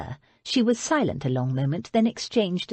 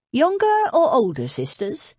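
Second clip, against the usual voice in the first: put-on a light northern accent with a high, laughing prying; chuckling northern father, a touch higher; nosy northern accent; 7 semitones higher, 4 dB louder than usual. A narrator's reading. Mister Parslow to Abby, a high, laughing question in a light northern accent. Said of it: "broad northern accent".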